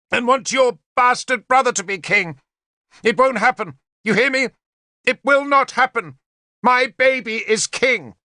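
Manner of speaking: high, frantic weeping scream; screaming in tears. A narrator's reading. Queen screaming at Morgan over her baby, high weeping rage. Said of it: "She was screaming and weeping".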